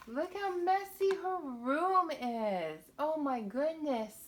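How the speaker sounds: high, loud, slow exclaim; mock shock. Showing the children the messy room, mock shocked. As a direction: gasp at the mess for the kids.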